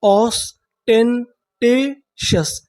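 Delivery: very slow, drawn-out syllable-by-syllable reading; ऑस्टेंटेशस drawn out slowly, syllable by syllable; slow syllable demo. Shown as a demonstration. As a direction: stretch each syllable of ऑस्टेंटेशस for students. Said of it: "तो हमारी पूरी meaning क्या बनी?".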